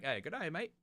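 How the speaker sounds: put-on a light Australian accent for the dog's greeting; lightly imitated Australian accent, cheery. Streamer voicing his Australian Shepherd dog, mild Australian accent. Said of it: "speaking back to me in an Australian, uh, accent".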